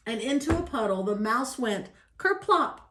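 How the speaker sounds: ordinary delivery, nothing marked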